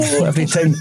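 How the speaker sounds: ordinary delivery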